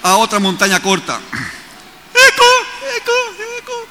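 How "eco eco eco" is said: very high, fading mountain echo. Shown as a demonstration. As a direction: call out like an echo. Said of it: "El eco de una montaña".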